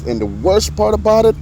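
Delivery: normal talking voice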